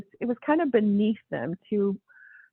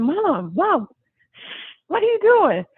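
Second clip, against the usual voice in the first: rising, louder mom what are you doing; much higher, louder embarrassed plea; alarmed shush; 9 semitones higher, 4 dB louder than usual. Retold or imitated. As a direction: call her down in alarm. Hushing excited mom.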